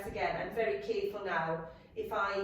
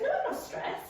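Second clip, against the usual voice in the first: high whispery mock denial. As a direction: brush it off in high-pitched denial. Mimicking someone in denial.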